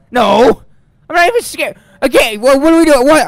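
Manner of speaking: high, loud panicked yelling; real fright, voice shooting up high and loud; scared panic. Real, not acted. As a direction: blurt it out in a fright. Panicked after jump scare.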